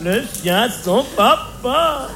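slow, drawn-out pet talk; doting owner's singsong greeting; cooing to a dog. Acted. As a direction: coo to the pet, stretching the vowels. Comedian coos to his pet dog.

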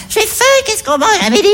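high little whiny voice; small high childish voice. Retold or imitated. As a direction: play the husband as a needy child. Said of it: "dit d'une petite voix".

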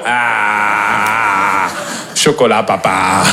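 put-on low, slow dopey kid voice; dim child voice. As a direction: play the slow kid mumbling at dad. Voicing the wall-licking child.

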